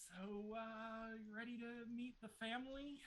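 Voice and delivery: high, fawning voice; butter them up in a high voice; Moorcroft's voice. Acted. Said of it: "Moorcroft is down there like".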